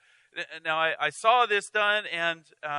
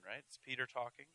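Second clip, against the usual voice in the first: normal talking voice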